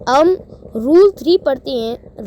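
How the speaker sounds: normal talking voice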